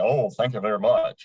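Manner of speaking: lightly voiced crooner thank-you. Trainer imitating a singer charming the ladies. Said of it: "he had great tone".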